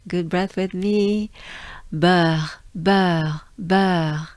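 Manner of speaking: Beurre with rounded eu and throaty r; beurre, gliding into R; French beurre. Shown as a demonstration. Teacher modeling beurre. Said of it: "like this".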